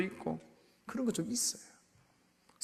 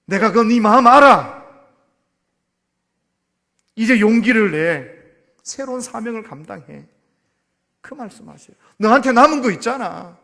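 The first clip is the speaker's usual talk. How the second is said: louder, ringing words of courage; God's strong encouragement; 3 semitones higher, 13 dB louder than usual. Acted. God urging Gideon to take courage, loud preaching. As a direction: boom out reassurance to lift him. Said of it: "하시는 말씀이".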